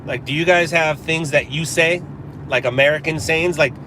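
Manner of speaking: usual speaking voice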